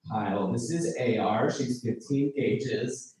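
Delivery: put-on slow, proud intro of a baby or gun; lightly voiced friend, drawn out; hometown friend introducing. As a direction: show off the item like a proud parent.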